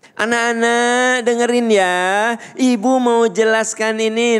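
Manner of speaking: high, projected classroom voice; loud teacher voice. Shown as a demonstration. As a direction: address the class without a mic. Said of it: "nah, gitu keras".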